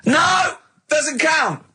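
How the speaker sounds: high, sharp cry of objection; real indignant outburst; outraged protest. Real, not acted. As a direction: shout it down, it doesn't count.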